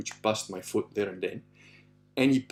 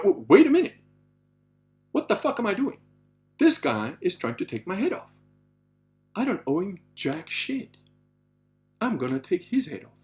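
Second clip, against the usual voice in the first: higher-pitched, halting self-talk with long pauses; indignant realization; 7 semitones higher than usual. Retold or imitated. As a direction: work it out aloud, affronted, phrase by phrase. Retold moment of sudden resolve.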